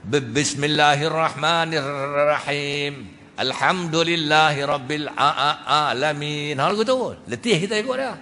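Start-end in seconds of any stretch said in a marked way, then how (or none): 0.1-6.5 slow, stuttered recitation; slow mock stammer in prayer; imitating stammering imam. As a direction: mimic an imam catching on each word. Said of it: "Tok Imam gagap tu macam ni".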